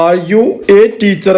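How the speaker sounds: very slow, clear teaching question; slow example question. Shown as a demonstration. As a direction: ask it slowly so learners hear the a. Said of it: "എന്നാണ് ചോദിക്കേണ്ടത്". (പതുക്കെ വ്യക്തമായി ചോദിക്കുന്നു)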